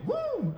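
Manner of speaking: high-pitched "woo" whoop; party woo. Shown as a demonstration. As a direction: let out a sorority-girl woo. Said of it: "Neither will wooing".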